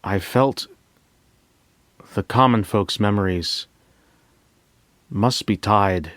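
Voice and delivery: ordinary delivery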